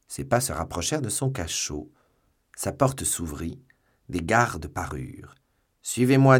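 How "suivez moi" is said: loud, high, abrupt command; commanding order. A narrator's reading. Officer arrives with guards for the prisoner, commanding. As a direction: call it out as a firm order.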